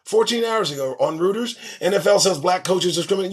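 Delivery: normal talking voice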